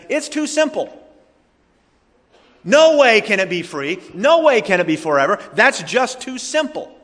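high, incredulous mock objection; foolish scoffing. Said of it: "man looks at God's way and foolishly says".

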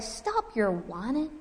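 mock scolding. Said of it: "God doesn't say".

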